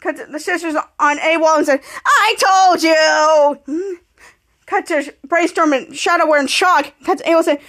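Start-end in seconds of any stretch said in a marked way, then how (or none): 2.1-4.0 very slow, stretched "I told you"; acted pained wail. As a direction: howl it out in mock pain. Injured character complains.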